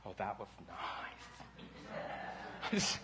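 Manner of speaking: soft, barely-there cheer; hushed deadpan approval; underwhelmed mock reaction. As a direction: murmur it, totally unimpressed. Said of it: "Did you go".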